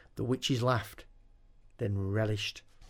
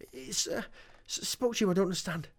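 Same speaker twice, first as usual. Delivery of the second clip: shocked stammer; 8 semitones higher, faster than usual. Acted.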